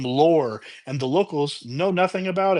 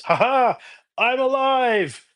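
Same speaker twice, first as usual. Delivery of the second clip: high, slow, gleeful triumphant voice; cheerful announcement; 10 semitones higher, 3 dB louder, slower than usual. Retold or imitated. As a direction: announce it like a villain delighted to be back. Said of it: "cheerfully announces".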